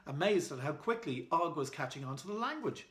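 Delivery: ordinary delivery, nothing marked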